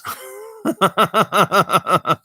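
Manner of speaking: light laugh after the punchline; amused laugh. Real, not acted. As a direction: giggle at your own dad joke. Laughs at own joke.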